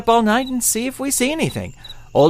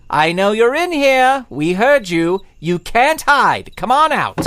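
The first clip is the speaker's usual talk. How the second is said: calling out; 3 semitones higher, slower than usual. Acted. Calling out to hidden intruder.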